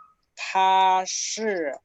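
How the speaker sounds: 他是我的儿子 drawn out slowly; slow dictation. Shown as a demonstration. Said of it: "Vamos a escribirlo". (dictando despacio)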